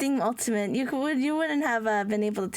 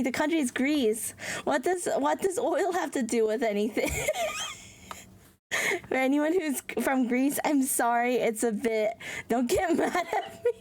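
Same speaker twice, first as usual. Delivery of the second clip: ordinary talk, nothing marked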